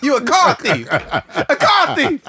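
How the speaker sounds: high, cracking up while teasing; laughing accusation. Real, not acted. Friends ribbing him about keeping the money, laughing accusation. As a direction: tease him through big laughter.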